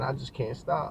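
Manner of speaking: ordinary delivery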